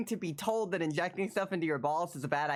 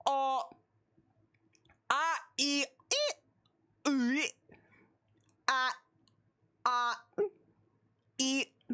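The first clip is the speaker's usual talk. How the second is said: high vowel sounds; 5 semitones higher than usual. Acted. Reads only the vowels, sung.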